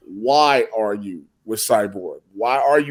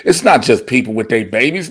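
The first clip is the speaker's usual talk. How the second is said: normal talking voice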